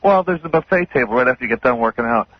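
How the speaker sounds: ordinary talk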